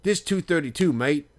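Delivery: high, loud mock Aussie insistence; Australian accent. Aussie fan corrects the other group about his seat, in an imitated Australian accent. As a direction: roughly imitate a cocky Australian. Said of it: "he had a thick, uh, Australian accent".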